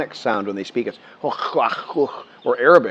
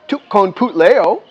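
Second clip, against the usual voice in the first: protesting; 10 semitones higher, 8 dB louder, slower than usual. Retold or imitated. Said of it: "I'm like".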